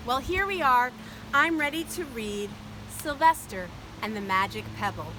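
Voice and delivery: plain reading voice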